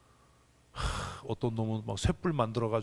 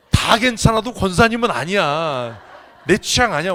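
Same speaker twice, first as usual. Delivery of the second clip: loud teasing declaration; 5 semitones higher, 10 dB louder than usual. Real, not acted. Joking loudly about a deaconess.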